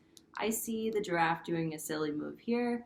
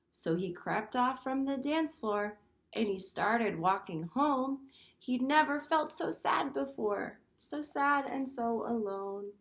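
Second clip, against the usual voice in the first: put-on a touch of sadness, read to children; tender storybook reading; 4 semitones higher than usual. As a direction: let the sadness show gently. Teacher reads picture book aloud.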